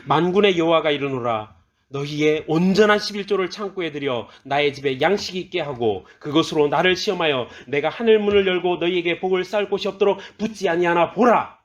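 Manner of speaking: loud, sustained proclamation building in pitch; ringing, forceful pulpit reading; declamatory scripture reading. Acted. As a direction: proclaim the verse as God's own word. Reads a scripture verse aloud.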